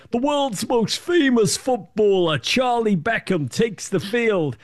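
high, slow, grand announcer voice; mock American commentator. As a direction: ham up a clueless TV play-by-play. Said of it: "The commentator said".

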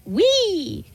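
"Wee" stretched into a long high eee. Shown as a demonstration. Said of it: "I do a W with a bunch of E's".

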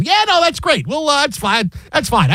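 a hint of Lane brushing it off; mock Lane. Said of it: "Uh, Lane, you just returned the kickoff".

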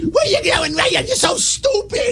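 very high, drawn-out mock screech; Danielle. Host mocking a co-host to her face, high screechy caricature. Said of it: "Danielle talks like this".

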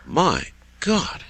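high, stunned exclamation; astonished. Real, not acted. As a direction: react in real amazement. Host reacts to the wind report.

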